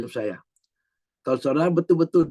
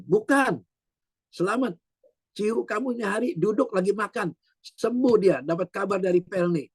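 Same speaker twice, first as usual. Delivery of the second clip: higher-pitched, eager correction; excited retold news; 6 semitones higher than usual. Mother telling her son his relative has recovered, excited. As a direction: break the good news in a raised pitch.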